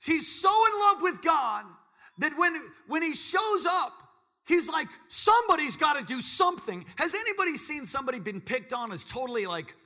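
high, loud fervent preaching; impassioned sermon climax; preaching at full force. Acted. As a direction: drive it home to the youth.

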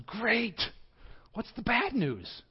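put-on an old fan perking up at news; high, slow excited old man; old man Carl. Carl answers ghost in joke.

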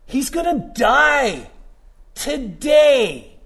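slow, high shouted emphasis on today; emphatic shout. Real, not acted. Preacher to congregation, shouting emphatic disbelief. Stressing the thief's death.

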